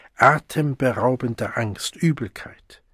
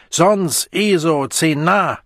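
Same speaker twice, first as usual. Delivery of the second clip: slow, higher, louder mocking jeer; savage mockery; 5 semitones higher, 4 dB louder, slower than usual. A narrator's reading. The Savage jeering at the Arch-Community-Songster, slow and scornful. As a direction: spit out the words in scorn. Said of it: "mit wildem Spott".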